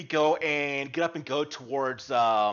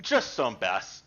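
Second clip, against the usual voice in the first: lower, unhurried humble fisherman; gruff-free friendly old fisherman, lower voice; fisherman Dale; 8 semitones lower than usual. Acted. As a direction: speak as a polite, simple fisherman.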